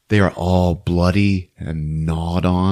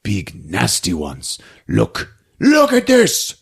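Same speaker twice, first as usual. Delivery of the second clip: a hint of an angry hunter, high at both ends; lightly voiced furious man; angry Aimo; 6 semitones higher than usual. Aimo raging over the dead reindeer, lightly voiced.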